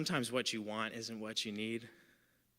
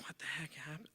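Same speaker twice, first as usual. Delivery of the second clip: whispered disbelief, higher pitch; stunned whisper; 5 semitones higher, 10 dB quieter than usual. Retold or imitated. Partygoer reeling from shocking news, stunned whisper. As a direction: imitate shocked inner thought under the breath.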